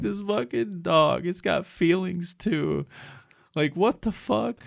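high, slow, teary whine; mock weepy whine. Crying over a rescued dog video, high weepy whine. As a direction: stretch it out like you're about to bawl.

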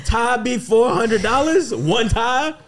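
high, laughing grandpa complaining; old man voice. Acted. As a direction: whine like an old granddad about bills. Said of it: "All right, Paw Paw".

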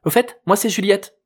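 put-on high, proud teen girl; proud announcement. A narrator's reading. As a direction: introduce yourself proudly, with a smile in the voice. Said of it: "annonça-t-elle fièrement en retrouvant son sourire".

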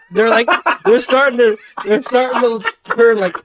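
laughing through the words; giggling, words breaking up with laughter. Real, not acted. Laughing at own red lips.

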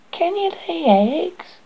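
high, slow haughty cat; snooty bored cat. Acted. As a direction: look down on the duckling. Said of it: "the cat could, would not hear of it".

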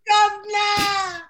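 very high, drawn-out British call; mock British cry. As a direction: wail it in hammy British. Repeating the British caricature cry.

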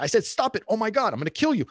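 high, exasperated scolding of a dog; frustrated. Acted. Owner yelling at the dog, frustrated. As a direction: lose your patience with the dog. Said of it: "And then you get frustrated".

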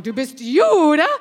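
loud, high mock bellow in German; bellowing at full lung. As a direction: imitate the teacher roaring an incredulous question. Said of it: "bellowing at the top of her lung".

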